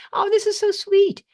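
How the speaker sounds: high, loud burst of delight; delighted gushing. Real, not acted. Presenter reading kind messages from webinar attendees, gushing with delight.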